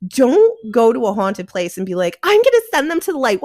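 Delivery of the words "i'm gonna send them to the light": mocking. Mocking naive ghost hunters.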